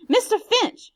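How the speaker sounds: high, loud shout of his name. Acted. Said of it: "she shouted".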